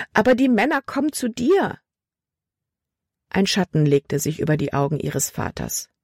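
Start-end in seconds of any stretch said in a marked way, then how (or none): 0.1-1.8 frightened pleading. A narrator's reading. Frightened girl pleads with father.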